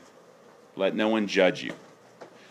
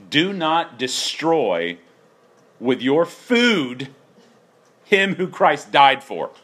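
slow, pointed sarcasm; sarcastic reading; 5 semitones higher, 3 dB louder, much slower than usual. Retold or imitated. Preacher reading Paul's verse to the congregation, sarcastic. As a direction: drag out each phrase with biting irony. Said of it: "Notice the sarcasm here".

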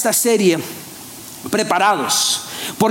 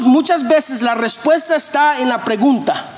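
ordinary delivery

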